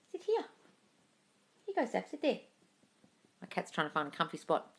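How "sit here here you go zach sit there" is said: soft, high coaxing pet voice; cooing to a pet. Real, not acted. Host helping her cat find a comfy spot, cooing. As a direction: coax gently in a high little voice.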